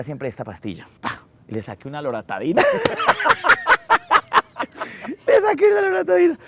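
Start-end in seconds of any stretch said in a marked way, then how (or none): 2.7-5.2 loud high burst of laughter; big laugh. Real, not acted. Laughs at own story. 5.3-6.4 high, louder, laughing through the words. Real, not acted. Repeats punchline laughing.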